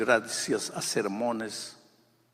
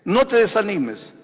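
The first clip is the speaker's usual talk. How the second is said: higher, louder preaching push; predicación a todo pulmón; 8 semitones higher, 8 dB louder than usual. Acted. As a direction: urge them on at full force. (predicando con fuerza, más alto y fuerte)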